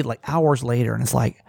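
normal talking voice

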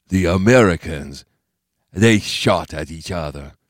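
put-on accented old-man character voice; Bo Pan's voice. A narrator's reading. Bo Pan answering Steve, accented old-man voice.

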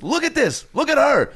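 very high-pitched mock disbelief; imitated high, incredulous rant; incredulous scolding. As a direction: berate a friend in comic outrage. Acting Joel berating the young man.

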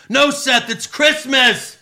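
high, loud heckler shout; imitated fan yell. Random fan yelling at Seth over Christmas, high loud shout. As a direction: holler it like a fan from the crowd. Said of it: "one random fan yells".

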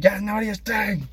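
high-pitched strained mock yell; fast, exasperated outburst, voice way up; annoyed. Larry finally losing it at J B, annoyed mock yell. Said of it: "He was just, like, annoyed".

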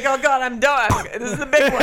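loud, high, hammed-up alarm; laughing panic, played big; mock final gasp. Imagining their head exploding at the accent, mock dramatic alarm. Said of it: "I'd be like".

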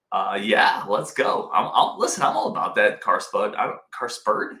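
usual speaking voice

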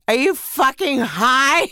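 mocking your own foolish self; incredulous inner voice. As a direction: scoff at yourself as if it's crazy. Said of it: "the other side was like".

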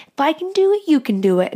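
high, loud fitness-instructor cheer; workout video hype. Retold or imitated. Mocking a fitness video cheering on viewers, loud. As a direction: pump them up like a workout video. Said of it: "workout videos that are like".

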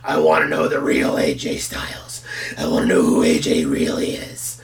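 low, unvoiced menacing whisper; whispered Vince. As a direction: breathe it low like a scheming boss. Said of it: "and he's like".

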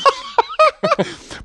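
very high shrieking laugh. Real, not acted. Cracking up at his cohost's Oxy joke, a high shrieking laugh.

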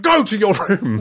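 father losing patience with son; mock exploding. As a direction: snap at the child, lightly. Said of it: "me exploding".

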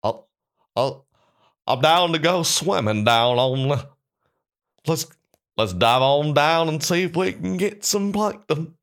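slow, high dopey Southern crooner. Acted. Auditioning as a dumb fish, uneducated [name] voice. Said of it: "Like if [name] was uneducated".

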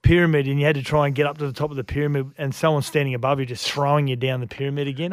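usual speaking voice

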